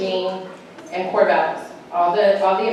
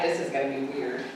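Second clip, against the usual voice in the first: lower, softer, doubtful; wary mumble; 8 semitones lower, 9 dB quieter than usual. Retold or imitated. Daughter entering church for the first time, quiet and wary. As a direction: say it under your breath, uneasy. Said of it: "Katie's like".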